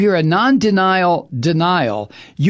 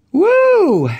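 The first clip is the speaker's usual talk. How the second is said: high, loud "woo"; loud whoop; 13 semitones higher, 5 dB louder than usual. Real, not acted. Host capping his rant on a guilty official, loud whoop. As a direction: let out one big high whoop.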